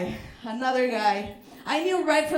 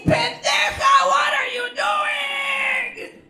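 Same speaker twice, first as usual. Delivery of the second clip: higher, louder, dragged-out scolding yell; scolding ancestors; 11 semitones higher, 4 dB louder, much slower than usual. Retold or imitated. Her ancestors yelling at her for dating a conquistador, scolding. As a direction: shriek like furious ancestral spirits. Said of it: "my ancestors saying".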